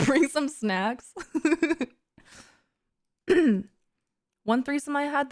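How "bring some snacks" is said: higher, slow, after a sigh; resigned. Pretend. Left out of the threesome, fetching snacks, resigned. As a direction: say it in sulky resignation. Sighing, resigned third wheel.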